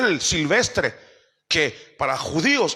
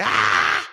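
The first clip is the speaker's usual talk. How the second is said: demon scream; 12 semitones lower than usual. Acted. Preacher voicing a demon cast out by disciples, deep shriek. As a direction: let out a low growling demon yell. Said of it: "Cuando el demonio grite".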